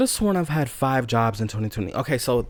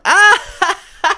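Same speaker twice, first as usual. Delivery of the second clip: sudden high loud shriek; high hooting laugh; mock laughing shriek; 10 dB louder than usual. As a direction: squeal like coworkers cracking up. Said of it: "They're like".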